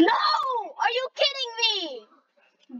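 high, drawn-out shouted disbelief; outraged squeal. Real, not acted. Gamer losing the race, high slow outraged wail.

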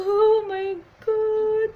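long, high, loud exclamation; big acted "oh my God" wail; drawn-out shocked cry. Acted shocked outburst in manga.

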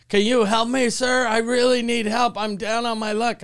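mock pleading cold-call pitch; telemarketer pitch. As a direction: imitate a beggar reciting a sales script. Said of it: "like they were working in telemarketing".

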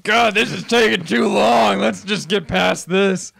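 mock impatient shout. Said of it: "you're like".

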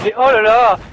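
loud dismayed "oh là là"; exasperated exclamation. Real, not acted. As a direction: blurt it out in disbelief. Reacting to game chaos.

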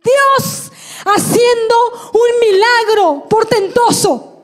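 high, slow, emphatic shout; proclamación a gritos. Real, not acted. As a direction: proclaim the miracle at full force, word by word. Testimony climax, proclaims miracle. (proclamando a gritos, lento y agudo)

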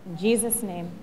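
low, measured pastoral prayer. Real, not acted. Pastor leads the congregation in prayer before reflection, low and reverent. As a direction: pray gently, with unhurried pauses, softening to amen.

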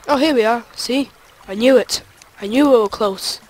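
loud, excited I-knew-it; triumphant discovery. Real, not acted. Player finally finding the spot, loud and triumphant. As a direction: call it out loudly, vindicated. Finds what they were seeking.

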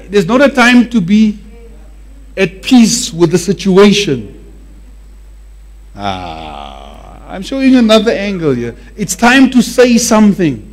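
ordinary talk

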